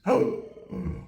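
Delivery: a sharp, breathy gasp of alarm; shocked gasp. Acted. Lying in bed, suddenly remembering the taxes: shocked gasp. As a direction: gasp like you just remembered something awful. Realizing taxes undone at midnight.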